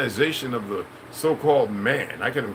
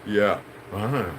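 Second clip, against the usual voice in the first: ordinary delivery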